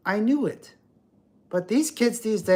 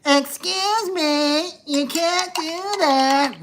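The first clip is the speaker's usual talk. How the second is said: much higher, slow, condescending; 11 semitones higher, 4 dB louder, much slower than usual. Retold or imitated. [name] mimicking a gym woman scolding, high and slow. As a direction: scold like an entitled Karen.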